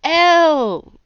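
high, loud delighted call; gleeful shout across the room; crowing. A narrator's reading. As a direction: crow her name in triumph. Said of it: "Zed crowed".

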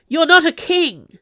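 high, very loud accusation; furious scream. A narrator's reading. Mad cousin pinning the Duchessa down, screaming at her. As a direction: scream it out in outrage. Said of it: "he screamed at her".

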